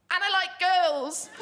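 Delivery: high, loud, slowed panicked blurt with a laugh. Retold or imitated. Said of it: "in a panic".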